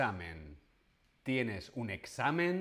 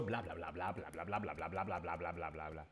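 low, soft bla bla babble; rapid nonstop chatter; 7 semitones lower, 8 dB quieter, much faster than usual. Shown as a demonstration. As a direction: mumble endless bla bla. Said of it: "una persona que habla mucho". (charla sin parar en voz baja)

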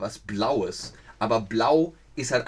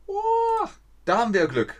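a high, bright jump of joy on oh; pleasantly surprised, louder; delighted surprise; 5 dB louder than usual. Real, not acted. Zuschauerin kommt aus Tschechien. (freudig überrascht, hoch und lauter)

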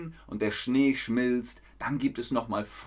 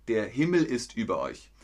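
usual speaking voice

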